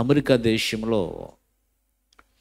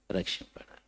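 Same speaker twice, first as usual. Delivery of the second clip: barely breathed, voiceless whisper; faint whisper; 18 dB quieter, faster than usual. Acted. Sick child telling of his father, in a faint whisper. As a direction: let the word fade to a breath. Feverish child's last words.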